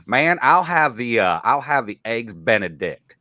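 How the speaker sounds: mock Southern drawl ordering eggs Benedict; Southern accent. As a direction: lay on a Southern drawl at a New York diner. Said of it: "hate the Southern accent".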